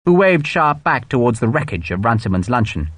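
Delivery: ordinary delivery, nothing marked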